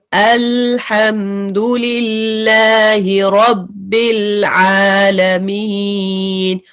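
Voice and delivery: drawn out Quranic recitation with a medium lam; slow recitation of रब्बिल आलमीन. Shown as a demonstration. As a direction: recite each word slowly and melodically. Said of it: "तो कभी भी आप पढ़ेंगे आलमीन".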